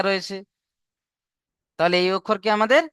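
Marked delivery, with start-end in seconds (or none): none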